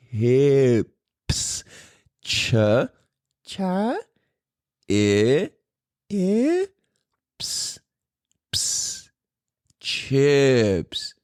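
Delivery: "chips" broken slowly into cha, i, ps, then whole; slow phonics demo of "chips", each part separated; sounding out a word. Retold or imitated. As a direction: coach "chips" sound by sound with pauses between. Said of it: "say chips".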